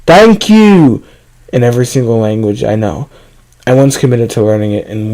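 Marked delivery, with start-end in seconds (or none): 0.1-1.0 loud, high-pitched yell; shout. A narrator's reading. Narrator trying to confuse the unwanted guest, shouting. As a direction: yell it out at full voice. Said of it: "I shouted".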